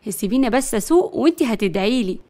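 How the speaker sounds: usual speaking voice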